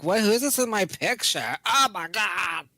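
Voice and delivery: ditzy selfie girl, hammed-up; duck lips mimicking. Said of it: "and she's like".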